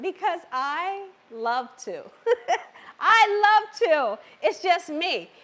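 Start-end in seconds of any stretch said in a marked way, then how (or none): 2.5-4.2 laughing. Real, not acted. Laughing at her own habit.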